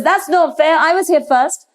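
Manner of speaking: high, whining complaint; whiny protest. Acted. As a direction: protest like a spoilt older brother. Child protesting unfair choice.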